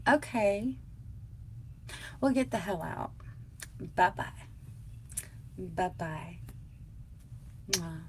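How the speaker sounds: sarcastic brush-off, trailing to quiet bye-byes; dismissive kiss-off. Retold or imitated. To her husband, showing him the door with a mild sarcastic kiss-off. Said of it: "That's what I would say".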